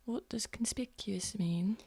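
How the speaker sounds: hushed, barely voiced query; whispered question. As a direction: whisper it as if trying not to be heard. Hushed exchange between sneaking characters.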